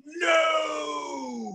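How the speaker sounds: long drawn-out wailing no; bear's cry of defeat. A narrator's reading. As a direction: stretch it out like a thwarted villain. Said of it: "And the bear said".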